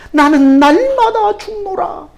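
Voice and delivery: slow and weighty, stress on 날마다; solemn slow quote. Shown as a demonstration. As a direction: lean on 날마다, falling to a grave close.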